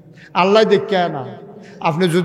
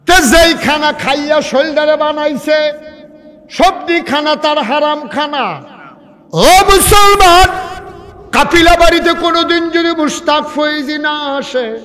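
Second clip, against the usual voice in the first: ringing, high-pitched exhortation; full-force preaching; 10 semitones higher, 8 dB louder than usual. Real, not acted. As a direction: proclaim it to the whole crowd, stretching the words.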